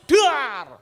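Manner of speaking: loud, explosive bang noise; high, booming vocal crash; imitated door slam. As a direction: shout a slamming-door sound. Imitates car door slamming.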